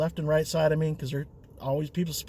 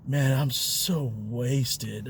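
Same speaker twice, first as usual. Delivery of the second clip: put-on slurred drunk; 5 semitones lower, 3 dB quieter, much slower than usual. Drunk passenger voiced in story.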